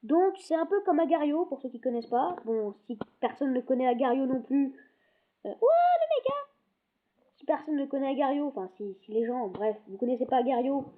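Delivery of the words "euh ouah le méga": amazed. Real, not acted. Huge blob appears in game.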